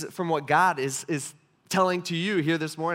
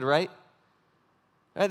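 usual speaking voice

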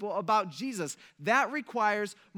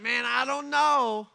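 higher, louder, slow unsure disciple; doubting disciple; 6 semitones higher, 8 dB louder, slower than usual. Acted. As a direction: drag out "Man, I don't know" like a doubter.

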